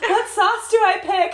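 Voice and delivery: high, loud, slow silly voice; mock helpless wife. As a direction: squeak it out like a clueless bride. Mocking wife's helpless question.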